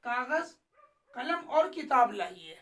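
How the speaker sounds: a clear short pause after "कागज", then "कलम और किताब लाइए" run together; pause at comma. Shown as a demonstration. Teacher showing how the comma is spoken, pausing after "कागज". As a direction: stop briefly on "कागज" before the rest. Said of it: "कागज के बाद थोड़ी देर हमें ठहरना है".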